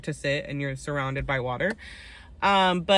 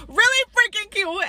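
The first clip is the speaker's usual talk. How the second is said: much higher, squeaky repeat of Really freaking cute; squealing gush; 4 dB louder than usual. Real, not acted. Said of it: "It's really freaking cute".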